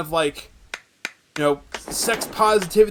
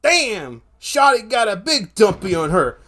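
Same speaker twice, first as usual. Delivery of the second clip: mock future slang; 5 semitones higher than usual. Future kids talking slang, hammed-up high mock voice. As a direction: ham up a slangy future kid, laughing at the end. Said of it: "that's literally how people are gonna talk".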